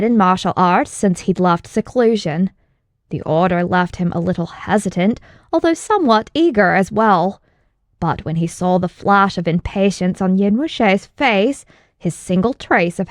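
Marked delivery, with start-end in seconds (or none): none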